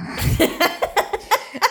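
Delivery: high laugh. Real, not acted. Laughing at mum's whisper.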